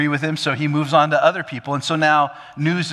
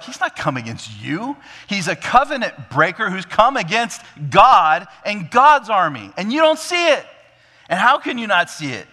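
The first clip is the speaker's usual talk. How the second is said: rising, loud exasperation; exasperated; 8 semitones higher, 6 dB louder than usual. Acted. David setting the army straight about God, exasperated. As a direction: plead in disbelief, pitch climbing high. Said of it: "a sense of absolute exasperation".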